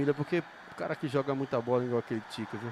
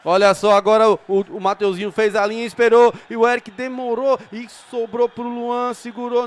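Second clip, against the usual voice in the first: rising excitement; 6 semitones higher, 12 dB louder than usual. Real, not acted. Dangerous attack, narrator rises.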